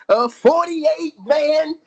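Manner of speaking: slow booming announcement; announcer shout. Pretend. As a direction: boom it out slowly. Mock GM announcement.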